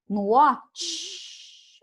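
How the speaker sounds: watch said very slowly with its final sound as just air; voiceless ending. Shown as a demonstration. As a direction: stretch out watch and end on breath. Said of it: "só vento".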